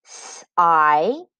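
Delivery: "S-I-L-N-C-E" as separate stretched sounds, not letter names; sounding out. Shown as a demonstration. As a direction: tap through "S-I-L-N-C-E" sound by sound. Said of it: "finger tap the sounds in each syllable".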